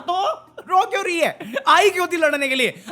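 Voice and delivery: high-pitched incredulous "रो" rising sharply; incredulous question. Acted. Repeating it to the audience, high incredulous question. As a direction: ask it again in disbelief.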